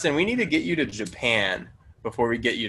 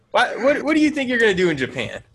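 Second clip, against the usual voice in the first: excited question; 11 semitones higher, 4 dB louder, faster than usual. Real, not acted. Presses friend about Japan.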